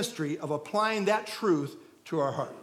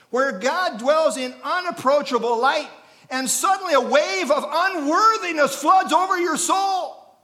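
louder and higher, rising to a shout; building to a shout; 6 semitones higher, 6 dB louder than usual. Real, not acted. Preacher describes the overwhelming holiness of God, shouting. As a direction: swell into a full preaching cry.

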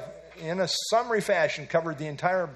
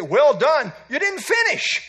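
high, loud mock divine verdict; preacher mocking God's verdict on quitters, high and loud; 9 semitones higher, 7 dB louder than usual. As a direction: play God with an ironic twist. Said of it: "I don't think God's gonna say".

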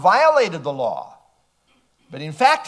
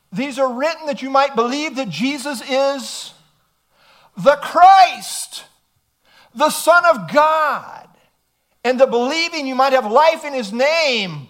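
rising, louder and higher recitation; ringing scripture reading building up; scripture proclaimed; 3 semitones higher, 4 dB louder than usual. Acted. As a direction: build each title of Christ. Quoting scripture with rising force.

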